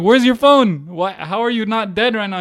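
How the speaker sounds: high, loud demand for answers; exasperated questioning. Retold or imitated. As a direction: retell grilling your friend, worked up. Exasperated questioning of missing friend.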